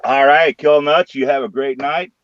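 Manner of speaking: usual speaking voice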